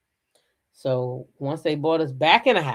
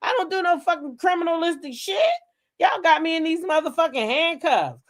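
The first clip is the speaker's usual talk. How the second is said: popping off; 11 semitones higher than usual. Retold or imitated. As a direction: let the anger spill out, pitched up. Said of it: "I was popping off at the police".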